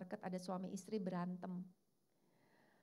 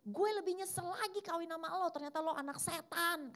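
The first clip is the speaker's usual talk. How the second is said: the husband yelling back even angrier; hammed-up furious husband in a quarrel; husband's angry retort; 10 semitones higher than usual. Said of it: "Suaminya nunjuk istrinya bilang gini".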